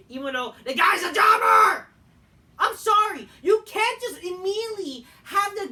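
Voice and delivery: yelled out loud and high, fed up; furious shout. Real, not acted. Ranting at fans about a jobber, furious shout. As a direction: shout it in real exasperation.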